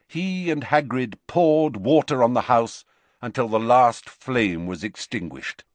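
usual speaking voice

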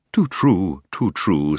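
mildly sad, hooting owl. A narrator's reading. Owl lamenting the king's departure, mildly sad. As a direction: hoot it with a touch of sorrow, sinking on the repeat. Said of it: "said the owl sadly".

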